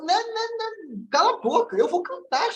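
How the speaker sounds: squeaky taunting inner voice, high and dragged out; mocking little self. Caruso's inner self taunting him, in a high mocking voice. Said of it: "Eu pequeno".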